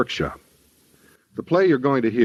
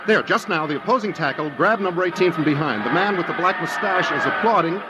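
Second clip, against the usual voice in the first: brisk, raised stadium announcer voice, pitched higher; live football play-by-play; 7 semitones higher than usual. Acted. Johnny Scott announcing the Gridiron Classic to his listeners, lively play-by-play. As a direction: call the game like a radio sportscaster on air.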